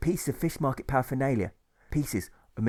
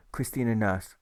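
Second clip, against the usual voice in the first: ordinary delivery, nothing marked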